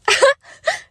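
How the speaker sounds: a high, loud, unhinged cackle; manic laugh. Acted. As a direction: laugh like she has lost her mind. Manic possessive outburst.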